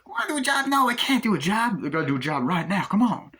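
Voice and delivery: high-pitched squealing refusal; whiny actor impression. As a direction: beg and refuse like a cartoon sidekick. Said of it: "doing the impressions of the, uh, actors".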